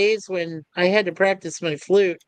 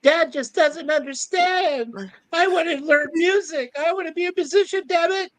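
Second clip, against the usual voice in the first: high-pitched, hammed-up protest; complaining young son; 8 semitones higher than usual. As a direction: plead like a frustrated kid. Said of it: "John basically tells his older brother".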